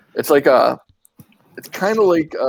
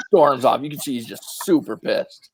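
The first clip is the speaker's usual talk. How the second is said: ordinary delivery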